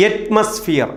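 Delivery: അറ്റ്മോസ്ഫിയർ opening on "aet", weak "mus"; correct pronunciation. Shown as a demonstration. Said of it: "ഒരിക്കൽ കൂടി".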